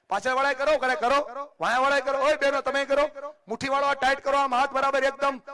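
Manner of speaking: rally the back rows, loud and high; loud call to the audience to raise hands. Real, not acted. Calling out to whole hall.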